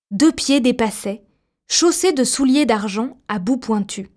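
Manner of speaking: usual speaking voice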